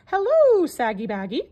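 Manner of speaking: high, loud teasing squawk; a cheeky bird calling out a greeting; parrot voice. A narrator's reading. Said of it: "the parrot said".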